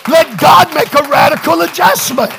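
loud, high, drawn-out pulpit shout; full-force preaching. Real, not acted. Preacher testifies the man got saved, full-force preaching. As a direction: press each phrase home to the congregation.

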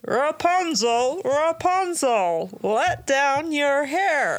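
high, slow falsetto calling up to the tower; a prince calling Rapunzel in a high, slow falsetto. Retold or imitated. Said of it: "in her falsetto voice and cracking up".

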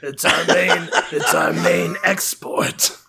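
lightly put-on monster voice; character voice. Playing along about coffin shipping, put-on character voice. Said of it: "Back into character".

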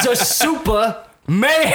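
slow, laughing punchline delivery; comedian impression. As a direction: drag out the punchline. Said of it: "He goes".

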